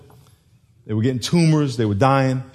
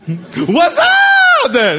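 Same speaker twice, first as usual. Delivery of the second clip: high, drawn-out goofy holler; joking yell with a laugh behind it; mock shouted greeting; 7 dB louder, much slower than usual. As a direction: stretch it into a long, loud, high whoop. Jokey shouted reply to God's call.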